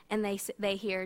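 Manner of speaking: ordinary delivery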